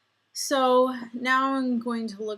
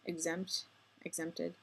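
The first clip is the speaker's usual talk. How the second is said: self-correcting mutter; 3 semitones lower, 12 dB quieter than usual. Real, not acted. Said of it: "I should get exempted".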